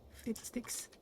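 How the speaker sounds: breathy, soft mock curse; whispered curse. Real, not acted. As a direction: mutter it under your breath.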